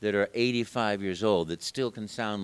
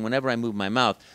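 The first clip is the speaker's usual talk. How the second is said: normal talking voice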